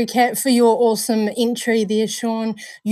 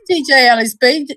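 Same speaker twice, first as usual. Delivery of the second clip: ordinary talk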